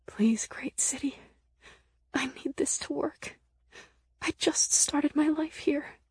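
high, hushed desperate plea; whispered prayer. A narrator's reading. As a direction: beg softly under your breath. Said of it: "muttering a prayer to the D F Z under my breath".

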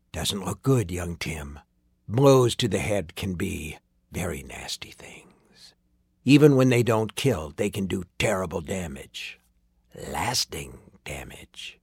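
low, soft, ominous villain; grave. A narrator's reading. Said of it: "His words were grave".

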